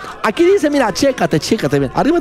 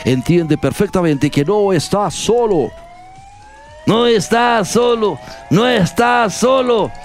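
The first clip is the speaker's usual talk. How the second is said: a mock-solemn read of the quote, lower; solemn dramatic reading; 4 semitones lower than usual. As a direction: read it like a grave farewell speech. Reads a solemn quote over music. (lectura solemne y dramática, en tono de burla)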